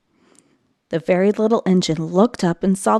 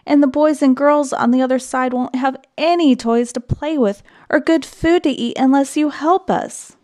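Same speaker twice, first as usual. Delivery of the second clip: higher, louder begging; pleading cry; 6 semitones higher, 4 dB louder than usual. A narrator's reading. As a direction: plead with the old engine. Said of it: "cried all the dolls and toys together".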